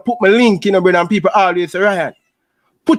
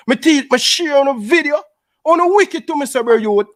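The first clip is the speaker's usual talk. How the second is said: high-pitched, wounded complaint; aggrieved protest; 5 semitones higher than usual. Real, not acted. Man accusing fellow YouTubers of being wicked to him, aggrieved. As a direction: plead your grievance in a raised pitch.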